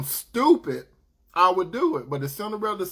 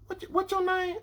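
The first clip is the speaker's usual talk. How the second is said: high woman's voice; 14 semitones higher, 4 dB quieter than usual. Retold or imitated. Woman asks his name.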